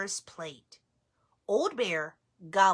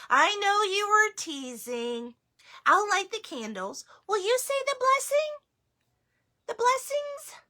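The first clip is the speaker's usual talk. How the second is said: higher sweet grandma voice; grandmother voice; 11 semitones higher, 3 dB louder than usual. Acted. Baba Brena asking the bear to bless, higher voice. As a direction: play a kind old grandmother.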